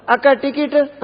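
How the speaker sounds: high-pitched ticket call; calling bus conductor. Acted. Conductor asks a woman passenger, high calling voice.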